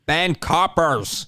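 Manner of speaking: slow, hammed-up Northern Irish TV cop; terrible Northern Irish accent. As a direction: boom it out in bad Northern Irish. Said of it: "my terrible Northern Irish accent".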